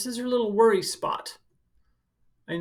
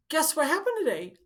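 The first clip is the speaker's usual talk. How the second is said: high, fast, bursting with news; excited child; 10 semitones higher, faster than usual. Retold or imitated. Child rushing to tell a grown-up the news, high and fast. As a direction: blurt it out like an eager kid. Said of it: "so excited to tell their grown-up".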